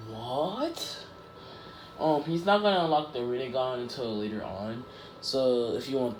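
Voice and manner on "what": mock surprise. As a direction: stretch it into a rising, disbelieving question. Retold startled reaction to quirk.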